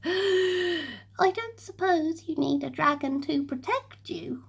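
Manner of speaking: soft, fading into a whisper; shy dragon asking in a near whisper; nervously. Acted. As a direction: hardly dare ask. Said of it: "he asked nervously".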